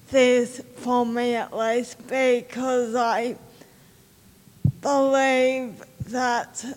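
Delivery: ordinary talk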